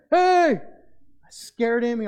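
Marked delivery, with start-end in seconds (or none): 0.1-0.6 very loud, high-pitched holler; yelled call. Retold or imitated. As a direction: holler at a kid from the car window. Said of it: "and yelled at him".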